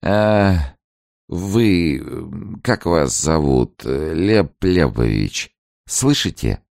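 put-on high, slow drunken calling; drunk man. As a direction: pull the words out like a drunk. Drunk Alferov tugs Ganin's arm.

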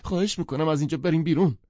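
wailing, rushed plea; moaning plea. Acted. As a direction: beg to get out. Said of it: "دیزی نالید".

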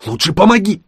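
high-pitched call for help; urgent demand. A narrator's reading. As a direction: demand help mid-effort. Straining witch demands help.

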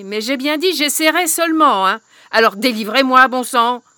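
she's had enough waiting; loud impatient hag demand; exasperated witch. Acted.

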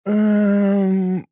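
a baffled drawn-out hum; puzzled hum. A narrator's reading. Woman baffled by the cultist's sermon, puzzled hum. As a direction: hum in confusion, unsure what to say. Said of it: "озадаченно промычала".

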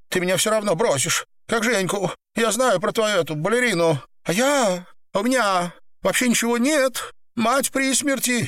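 high, hurried, upset female character; tearful woman confessing. Acted. Woman justifies betrayal to lover, high tearful pleading. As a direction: pour out excuses in a high voice.

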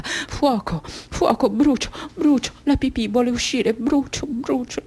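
high, fretful pain; anguished burning. Acted. Mutilated girl feeling the wound burn, anguished. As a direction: plead in rising distress without shouting.

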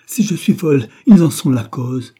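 loud, high narrated retort; angry retort. A narrator's reading. Caroline to Charlotte, who called her mad, angry retort. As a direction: fling the blame back forcefully.

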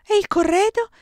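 high, loud burst of a question; sudden exclamation. A narrator's reading. Giulia suddenly remembers the bride's trousseau, exclaiming high and loud. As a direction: blurt it out as a sudden alarmed thought. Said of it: "esclamò improvvisamente Giulia".

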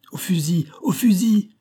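rally everyone urgently, building on the repeat; shouted order. A narrator's reading. Supplier ordering his men to arms, urgent cry. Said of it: "cria le fournisseur".

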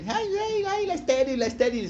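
high, singsong jeer that slides down; acted rival's mockery; mocking taunt. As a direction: tease her cruelly, starting on a high "ay". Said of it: "Penina se burlaba de ella".

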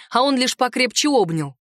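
plain reading voice

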